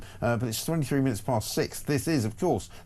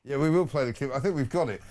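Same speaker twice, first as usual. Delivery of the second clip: ordinary talk, nothing marked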